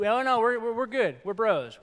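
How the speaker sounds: high, loud, buddy-buddy dismissal; breezy dismissal. Retold or imitated. Preacher mocking people who avoid forgiveness, high breezy bro talk. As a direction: brush it off like a bro. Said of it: "the thing that people say".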